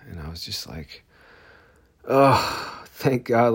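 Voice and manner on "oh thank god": higher, louder burst of relief; relieved exclaim. Retold or imitated. Patient to himself after the doctor's news, loud relief. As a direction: let it out, oh thank God.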